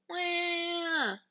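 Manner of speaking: high hammed-up crying wail; mock wail. Hansel bursts out in a mock baby wail. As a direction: fake a childish wail.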